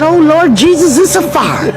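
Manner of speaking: normal talking voice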